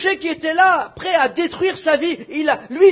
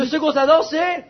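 ordinary delivery

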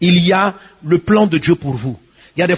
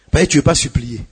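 usual speaking voice

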